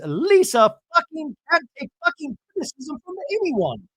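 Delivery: high-pitched mock rant; fighting. Bronwyn fighting with the producer, high mock outrage. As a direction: imitate a worked-up, squealed complaint. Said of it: "fighting with the producer".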